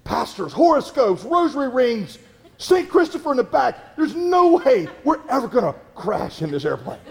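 high, hammed-up excitement; about to have a fit. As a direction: imitate a woman bursting with overexcitement. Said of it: "she's about to have a fit".

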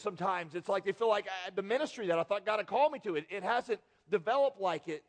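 ordinary talk, nothing marked

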